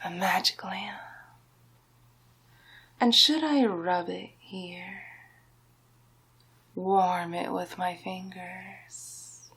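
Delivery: ordinary talk, nothing marked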